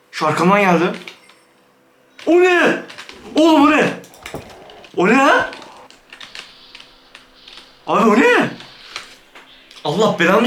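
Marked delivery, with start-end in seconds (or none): 2.3-8.5 startled alarm. Real, not acted. Scared by strange noise.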